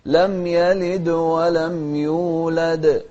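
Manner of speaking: لم يلد ولم يولد with a clean bounce on each د; correct tajweed recitation. Shown as a demonstration. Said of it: "كذلك الدال".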